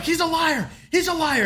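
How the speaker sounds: high-pitched accusing cry, volume held back; mock screaming. Captive to all these people, high mock scream. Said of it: "he's, like, screaming at the top of his lungs".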